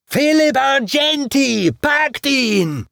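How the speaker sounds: high, loud yell of a mob; crowd shouting. A narrator's reading. Damned souls shouting at Argenti, very high yell. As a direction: scream it out as a frenzied crowd. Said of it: "schrien alle".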